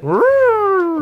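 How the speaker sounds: a short, high yelp of a dog, half-hearted; mock dog howl. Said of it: "What do we hear?".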